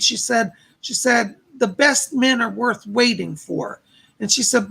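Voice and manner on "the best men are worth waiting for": usual speaking voice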